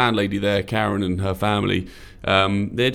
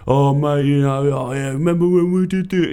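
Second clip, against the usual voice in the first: put-on louder, higher drunken mate; louder, higher tipsy pub mate; drunk bloke reminiscing; 6 semitones higher, 4 dB louder than usual. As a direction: boom out old memories, pint in hand. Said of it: "absolutely langers".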